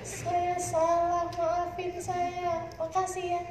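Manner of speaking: wife gently thanking her angry husband, slow and tender; humble apology. Retold or imitated. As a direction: give in sweetly, drawing each word out.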